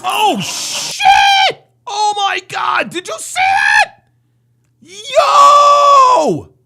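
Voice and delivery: shouting in disbelief, laughing; excited shouting. Real, not acted. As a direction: yell out, stunned and thrilled. Stunned by what happens outside.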